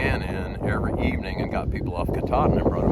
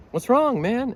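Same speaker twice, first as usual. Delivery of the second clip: concerned question; 4 dB louder, slower than usual. Retold or imitated. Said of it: "I'm like".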